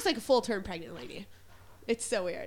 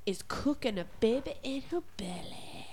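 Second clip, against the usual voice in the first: secret whisper; hushed reveal; 6 dB quieter than usual. Acted. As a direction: lean in and whisper the news. Whispered big announcement.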